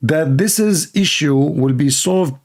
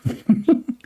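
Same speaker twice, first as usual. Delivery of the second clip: a short high laugh; a quick high chuckle; a high laugh at the comment; 9 semitones higher than usual. Real, not acted. Laughing at situation.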